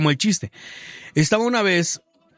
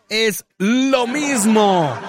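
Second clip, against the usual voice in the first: drawn-out repeat; 7 semitones higher, much slower than usual. Real, not acted. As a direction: stretch it out laughing.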